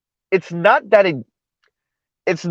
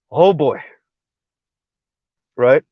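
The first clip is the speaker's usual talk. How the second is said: normal talking voice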